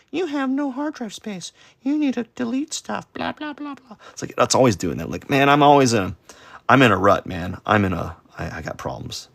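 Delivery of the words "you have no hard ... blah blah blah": much higher, softer, thin robotic nag; mock the nagging computer alert in a squeaky voice. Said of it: "my computer's always like".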